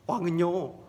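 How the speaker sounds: usual speaking voice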